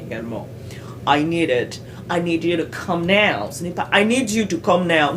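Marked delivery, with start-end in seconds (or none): 1.1-3.5 "I need you to come now" with NEED and COME punched loud; English stress. Shown as a demonstration. Teaching stress, content words punched in "I need you to come now". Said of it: "C'est ce qu'on appelle le stress". 3.9-5.2 "I need you to come now" with every word equally loud; no reduced words in "I need you to come now"; very flat. Shown as a demonstration. Wrong example for learners, flat even stress. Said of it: "Non, c'est très flat".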